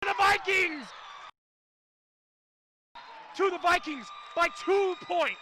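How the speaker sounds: high, loud commentator shout; excited winning call. Real, not acted. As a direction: shout out who took it. Commentator calls out the winning point.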